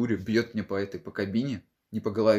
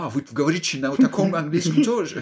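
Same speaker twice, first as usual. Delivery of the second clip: foreigner voice; 8 semitones higher, 8 dB louder than usual. Retold or imitated. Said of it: "И он просто такой".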